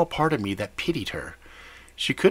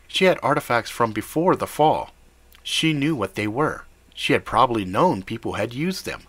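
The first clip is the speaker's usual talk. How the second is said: ordinary delivery, nothing marked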